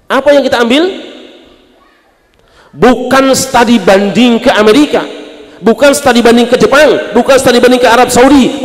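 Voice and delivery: high, loud preaching build; preacher thundering at the congregation; fiery preaching. Acted. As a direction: hammer each "bukan" like a rallying sermon. Preaching at full force.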